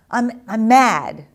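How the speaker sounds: put-on loud, slow, upset kid blurting it out; a kid yelling that he's mad while tears come; angry child. Child answering while crying.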